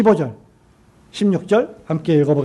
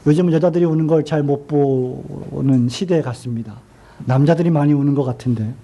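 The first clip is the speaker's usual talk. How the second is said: ordinary talk, nothing marked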